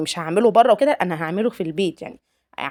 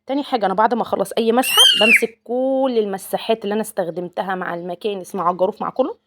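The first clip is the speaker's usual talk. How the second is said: ordinary talk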